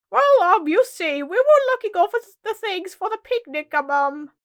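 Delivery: high, loud, stammering stall; nervous cook. Acted. Cook breaking bad news to the Queen, nervous.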